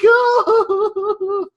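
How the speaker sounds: high, loud crying wail; child's wail. Retold or imitated. Boy crying to his parents, high loud wail. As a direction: let out a sobbing wail. Boy's wail after punchline.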